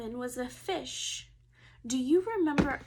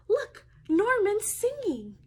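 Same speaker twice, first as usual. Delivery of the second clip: excited; 6 semitones higher, 6 dB louder than usual. Acted. Child excitedly points out fish.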